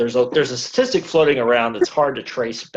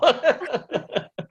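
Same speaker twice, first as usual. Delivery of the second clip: bursting into high laughter; real delighted laugh. Real, not acted. Host laughs again.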